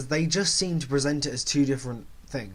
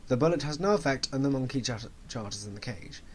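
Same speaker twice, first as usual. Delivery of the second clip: ordinary delivery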